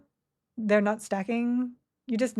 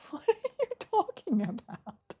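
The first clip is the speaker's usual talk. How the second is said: laughing disbelief; 8 semitones higher than usual. Real, not acted. Laughing reaction to chat.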